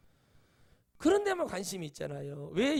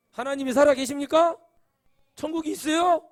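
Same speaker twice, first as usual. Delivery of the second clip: loud, higher ringing question; preaching shout; 8 semitones higher, 12 dB louder than usual. Real, not acted. Preacher calling out to the congregation, loud ringing question. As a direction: call it out over the congregation.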